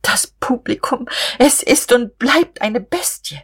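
grinding anger. A narrator's reading. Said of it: "knirschte sie". (zornig geknirscht, hoch und langsam)

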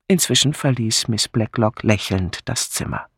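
ordinary talk, nothing marked

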